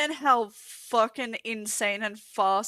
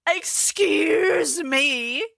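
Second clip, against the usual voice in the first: long, loud, high, rising disbelief; affronted; 5 semitones higher, 5 dB louder, much slower than usual. Real, not acted. Streamer accused of smoking that much, loudly affronted. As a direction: stretch it out in indignant shock.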